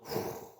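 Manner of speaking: soft blown puff of air; breathing life in. Acted. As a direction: blow out gently, imitating God's life-giving breath. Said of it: "생기를 불어서". (후 하고 숨을 불어넣는 소리)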